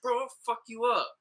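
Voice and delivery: playful tough-guy threat, pitched high; hammed-up jokey threat to a friend; mock threat. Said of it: "I'll be like".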